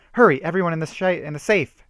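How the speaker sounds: high, loud urgent call; shouted. A narrator's reading. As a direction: hurry them all to safety. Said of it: "shouted Ashley".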